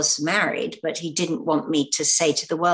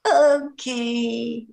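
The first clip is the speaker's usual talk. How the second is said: uneasy okay; 7 semitones higher than usual. Retold or imitated. Acting her uneasy reply in interviews.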